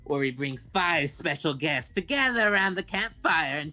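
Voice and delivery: slow, drawn-out spooky welcome; horror host. Pretend. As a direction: ghoulish host voice, slowly. Podcast intro as spooky host.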